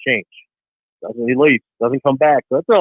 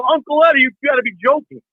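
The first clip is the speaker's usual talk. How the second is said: incredulous; 9 semitones higher than usual. Retold or imitated. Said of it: "I go".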